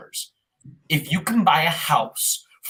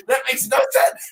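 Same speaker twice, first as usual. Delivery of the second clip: mock disbelief. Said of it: "And we're like".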